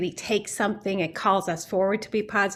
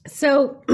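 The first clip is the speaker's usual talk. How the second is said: throat clearing; 7 semitones higher, 8 dB louder than usual. Real, not acted. Recovering voice after coughing.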